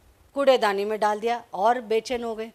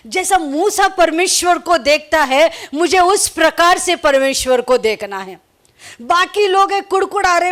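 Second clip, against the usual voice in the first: preaching at full force; 6 semitones higher, 5 dB louder than usual. Acted. Pastor exhorting the church about Moses, preaching hard. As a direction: drive the lesson home to the congregation.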